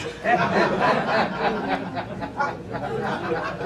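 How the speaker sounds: long soft laugh; real laugh. Real, not acted. Laughing at the license and registration line, soft real laugh. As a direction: let a quiet laugh roll on.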